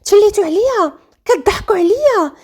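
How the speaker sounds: high, outraged tirade; yelling. A narrator's reading. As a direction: rail at them as if betrayed. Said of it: "بدا كيغوت وكيقول".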